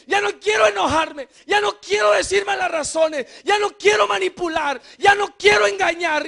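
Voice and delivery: loud, high preacher's plea; predicación enérgica. Acted. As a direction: cry out for freedom. Preaching at full force. (predicación a gritos, suplicante)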